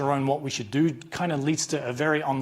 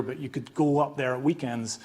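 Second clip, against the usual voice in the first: usual speaking voice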